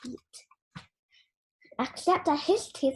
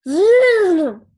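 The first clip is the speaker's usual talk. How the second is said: loud whooshing vroom; playful eruption noise; sound effect; 3 semitones higher, 10 dB louder than usual. Retold or imitated. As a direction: burst it out like an erupting volcano.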